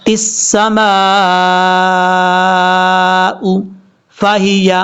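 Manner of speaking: long drawn-out السماء; slow Quran recitation. Shown as a demonstration. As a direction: melodic tajweed chant. Recites verse with tajweed.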